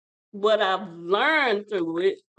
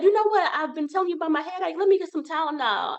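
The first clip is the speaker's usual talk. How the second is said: insistent; 7 semitones higher, faster than usual. Retold or imitated. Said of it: "so I said".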